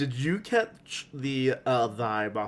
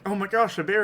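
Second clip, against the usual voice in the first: higher, a hammed-up gasp at a bear; mock surprise; 7 semitones higher than usual. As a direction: play the startled onlooker, half in jest. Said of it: "and they're like".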